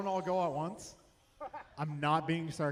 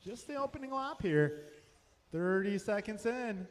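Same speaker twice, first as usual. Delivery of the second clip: brisk live race calling, lap times rattled off; race play-by-play. Acted. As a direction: call the race live for the crowd.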